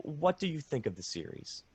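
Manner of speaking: ordinary talk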